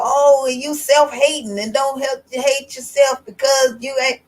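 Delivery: ordinary talk, nothing marked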